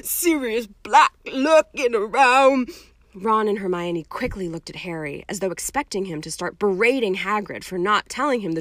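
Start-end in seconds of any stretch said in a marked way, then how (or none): 0.0-2.7 loud, anguished complaint; groaning. A narrator's reading. Hagrid listing Dumbledore's troubles, groaning. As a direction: groan on, louder and higher. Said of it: "groaned Hagrid".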